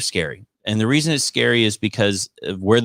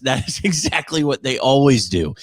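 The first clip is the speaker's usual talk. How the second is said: usual speaking voice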